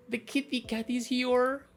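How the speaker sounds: high, slow little singsong; cutesy cat greeting. Real, not acted. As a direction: coo it slowly up high.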